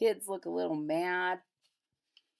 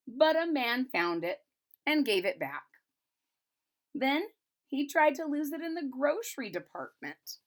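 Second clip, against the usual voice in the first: brighter storybook read, a bit higher; reading to children; 6 semitones higher, 5 dB louder than usual. A narrator's reading. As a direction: read it aloud like a picture book for kids. Reads picture book aloud.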